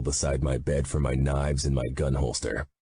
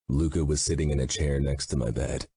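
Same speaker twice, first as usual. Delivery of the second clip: plain reading voice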